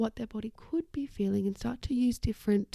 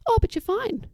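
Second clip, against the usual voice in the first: much higher, louder breezy brush-off; mock dismissal; 11 semitones higher, 5 dB louder than usual. Someone dismissing another's sore muscles, high mock brush-off.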